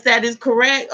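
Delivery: ordinary delivery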